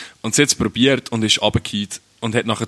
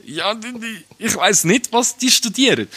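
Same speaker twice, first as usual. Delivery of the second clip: laughing while talking; 11 semitones higher than usual. Real, not acted. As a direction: laugh through the words. Laughing at dumb tourists.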